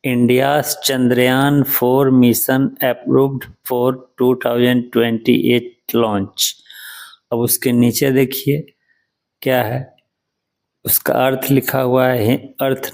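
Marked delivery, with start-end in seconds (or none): 0.0-6.6 slow read-aloud. Shown as a demonstration. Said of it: "English news पहला".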